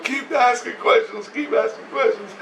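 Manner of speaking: high, tearful imitation; mock bawling. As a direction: imitate a man pleading while he cries. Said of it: "He's bawling his eyes out".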